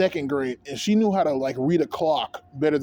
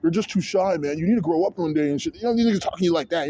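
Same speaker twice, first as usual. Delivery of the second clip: normal talking voice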